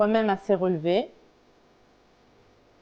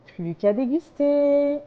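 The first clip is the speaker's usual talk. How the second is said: higher, slow and louder; excited announcement; 6 semitones higher, 4 dB louder, slower than usual. Real, not acted. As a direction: savour each word, proudly. Dish ready, repeated twice.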